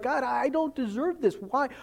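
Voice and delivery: high, amazed disbelief; can't believe you're being blessed; a stunned believer thanking God, high and amazed; overwhelmed gratitude. Retold or imitated. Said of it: "you're like".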